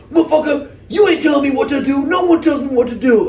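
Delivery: mock his defiant rant in a squeaky voice; imitating pissed-off kid. The kid talking back to the stunt rider, squeaky mock rage. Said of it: "gets out so pissed, He's like".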